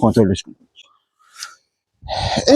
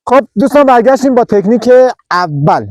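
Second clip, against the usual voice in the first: loud, high host comeback; punchy return announcement; upbeat announcement; 6 semitones higher, 4 dB louder than usual. Real, not acted. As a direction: kick off the segment like a TV host.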